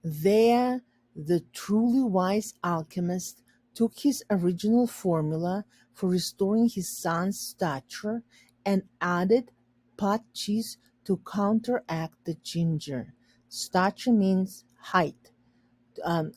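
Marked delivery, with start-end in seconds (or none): none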